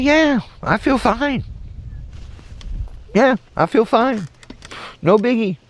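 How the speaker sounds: high-pitched, nonchalant reply; exaggerated high "all fine" voice; breezy reassurance. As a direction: play it innocent, voice way up high. Breezy reply to worried coworkers, retold.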